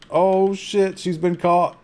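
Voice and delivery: high-pitched burst of gamer dismay; sudden high-voiced alarm. Real, not acted. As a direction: cry out as your character gets caught. Game character suddenly gets caught.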